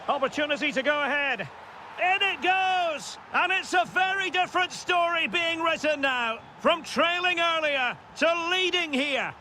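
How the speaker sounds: high-pitched shout as the team takes the lead; excited goal call. Real, not acted. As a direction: rush the chance, then stretch out the goal. Commentator calls the leading goal.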